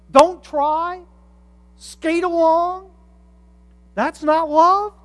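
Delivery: preacher mocking a lazy idea of love, sarcastic; sarcastic disbelief. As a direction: scoff at it as if it were absurd.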